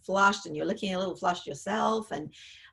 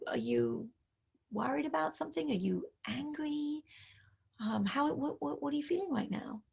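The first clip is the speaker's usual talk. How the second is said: put-on soft, quiet, tender questions to an upset teen; gentle, caring parent; 3 semitones higher, 6 dB quieter than usual. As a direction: ease her into talking, hushed and caring. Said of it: "And so you say".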